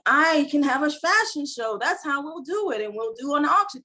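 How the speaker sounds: mock eureka, lifted and bright-voiced; excited idea. Sudden idea for a fashion show.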